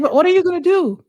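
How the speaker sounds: retold disbelief. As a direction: push the question out in disbelief. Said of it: "I'm like".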